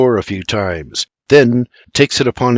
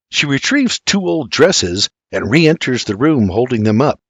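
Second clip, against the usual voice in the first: ordinary delivery, nothing marked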